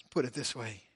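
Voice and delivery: ordinary talk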